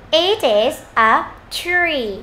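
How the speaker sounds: very slow, separated words; slow model reading. Shown as a demonstration. Teacher modelling for learners, very slow and deliberate. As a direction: lay out each word, long final tree.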